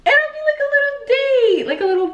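cutesy high voice. Shown as a demonstration.

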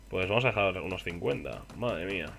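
usual speaking voice

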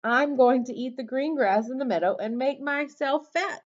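high little goat voice; high-pitched, unbroken goat reply. A narrator's reading. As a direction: answer brightly as a small goat, without stammering. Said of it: "said the goat".

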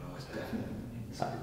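hushed whisper. Real, not acted. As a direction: breathe it out, no voice. Hushed in a creepy bunker.

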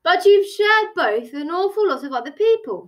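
lightly voiced indignant hare. Hare objects to the Hatter, mildly indignant. Hare in an acted tea quarrel.

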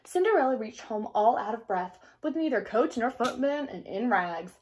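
usual speaking voice

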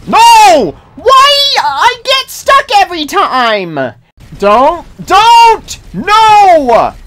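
frustrated shouting at the game; raging gamer. Real, not acted. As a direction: yell in real frustration. Gamer raging at getting stuck.

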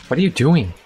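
ordinary delivery

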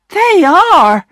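a glad wife chiming in; happily. Acted. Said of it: "agreed his wife happily".

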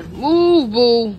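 sharp loud order to a dog; loud order to a stubborn dog; loud command. Real, not acted. Dog blocking the door.

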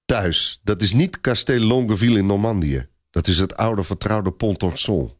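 usual speaking voice

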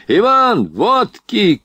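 high-pitched call for service; sudden shout. A narrator's reading. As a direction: yell an order to the servant. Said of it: "крикнул вдруг поручик".

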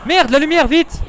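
high frantic shout; panicked shout. Real, not acted. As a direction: yell in panic for the light. Panic as light fails.

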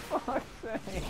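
laughing curse. Real, not acted. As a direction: curse through high laughter. Laughing in frustration.

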